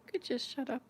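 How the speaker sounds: softly imitated, understated reply; calm, matter-of-fact. Son answering mother's question, calmly matter-of-fact. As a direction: say it quietly, without any edge. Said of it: "He said it so calmly and matter-of-factly".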